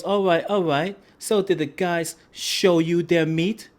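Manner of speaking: manager voice. Retold or imitated. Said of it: "He goes".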